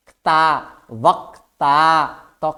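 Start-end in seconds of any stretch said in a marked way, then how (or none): 0.9-2.1 वक्ता drawn out slowly, half क then full त; slow demo. Shown as a demonstration. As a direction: stretch वक्ता to show the क्त cluster. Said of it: "last में क्या बोल रहे हैं". (वक्ता को धीरे, आधा क और पूरा त बोलें)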